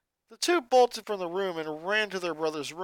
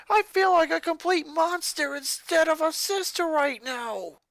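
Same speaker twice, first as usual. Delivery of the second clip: guilty; 11 semitones higher, 4 dB louder than usual. Acted. Confessing guilt after outburst.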